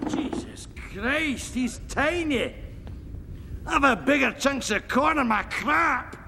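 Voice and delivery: broad Scottish brogue, crude and cheerful; thick Scottish accent, pleased with himself; Fat Bastard's Scottish. Acted. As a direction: play it as a coarse Scottish lout. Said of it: "Fat bastard".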